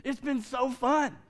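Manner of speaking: high, drawn-out delight; acted glee. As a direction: imitate a man relishing his prank. Quoting the gleeful junkyard man.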